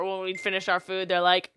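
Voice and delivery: usual speaking voice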